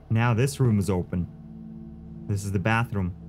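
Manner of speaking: ordinary talk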